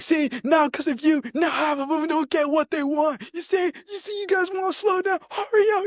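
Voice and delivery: high, mocking sergeant yell; imitating a yelling sergeant; mock drill sergeant scream. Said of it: "He's screaming at everyone".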